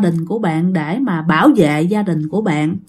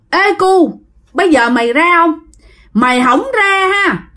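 put-on raised-pitch voice of a pushy young man; người thanh niên hăm dọa; 6 semitones higher than usual. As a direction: lightly play a stranger pressuring a kid. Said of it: "cái người thanh niên này nói rằng". (giọng thanh niên gọi ra, hơi cao, hăm dọa)

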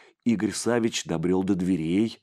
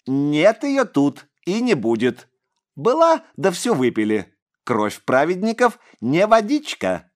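high, louder mocking imp; eerie child voice; 8 semitones higher, 6 dB louder than usual. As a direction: relish every word of scorn. Boy taunting.

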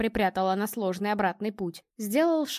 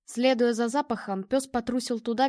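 ordinary delivery, nothing marked

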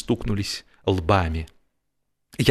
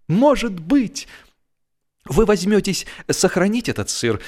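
hopeful wife; 9 semitones higher than usual. A narrator's reading. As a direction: sweetly coax him into it. Wife struck by an idea.

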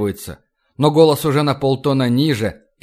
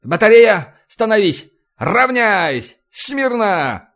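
high, loud parade-ground commands; shouting drill sergeant; 8 semitones higher, 3 dB louder, slower than usual. A narrator's reading. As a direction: bark the drill orders. Captain barks drill commands.

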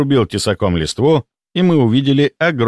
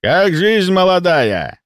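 put-on higher, slow, friendly old hunter; jovial slow question; 8 semitones higher, slower than usual. A narrator's reading. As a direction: tease the youngster kindly. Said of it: "Расплылся в улыбке Меткий".

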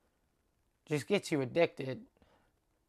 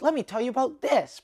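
louder, higher, emphatic opening; aggressive stage voice; 8 semitones higher, 7 dB louder than usual. Shown as a demonstration. Comic showing stage attitude to listeners, aggressive. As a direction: come on forcefully, pitched up. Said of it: "I'm really aggressive on stage".

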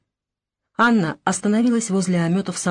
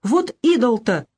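протяжно, выше, в отчаянии; всплеснуть голосом; отчаянно; 5 semitones higher, 3 dB louder, slower than usual. Acted. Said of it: "отчаянно всплеснула руками Марфа". (мать в отчаянии восклицает)

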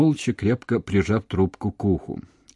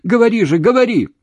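very high, loud pleading; desperate shout; 11 semitones higher, 8 dB louder than usual. A narrator's reading. Woman calling him on a dead line, shouting. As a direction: scream for him to keep talking. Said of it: "закричала она".

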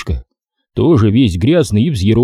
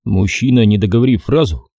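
ordinary delivery

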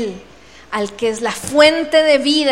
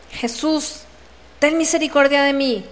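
normal talking voice

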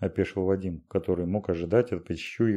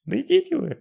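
shrill squeal, much higher and louder; squealing shout; 4 dB louder than usual. A narrator's reading. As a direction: squeal it out in panic. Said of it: "взвизгнул Вадим".

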